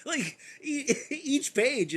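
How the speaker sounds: cracking up, wheezy high laughter through the words; can barely speak for laughing; laughing hard. Real, not acted. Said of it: "This is fucking hilarious".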